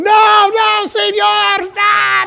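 high, loud panicked shout; frantic high shouting warning; panicked yell. Retold or imitated. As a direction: yell at the driver to stop. Yelling at the bus driver.

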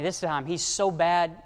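usual speaking voice

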